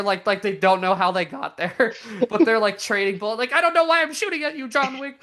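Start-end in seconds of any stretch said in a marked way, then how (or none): 3.5-5.2 much higher, fast, bewildered puppet voice; confused Muppet. Retold or imitated. Muppet firing at John Wick, high, fast and baffled. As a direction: squeak it out, lost and baffled. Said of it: "some of the Muppets are talking like".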